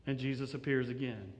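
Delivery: usual speaking voice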